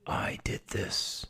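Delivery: slow, drawn-out whisper; hushed and deliberate, each word stretched; whispered villain confession. Acted. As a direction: confess it in a sinister hush. Villain's confession, first quiet take.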